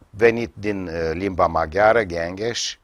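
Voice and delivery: ordinary talk, nothing marked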